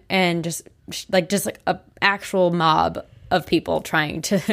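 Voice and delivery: ordinary talk, nothing marked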